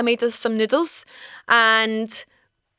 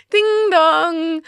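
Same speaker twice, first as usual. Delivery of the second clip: high sing-song chime; imitated doorbell; 5 semitones higher than usual. Storyteller arriving at her parents' door, high doorbell chime.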